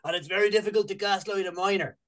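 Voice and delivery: put-on light Welsh accent, lilting. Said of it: "that was my Welsh accent".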